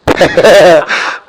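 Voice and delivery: short low laugh, falling away; a quick amused chuckle. Real, not acted. Commentator laughing at his co-host's joke, low chuckle.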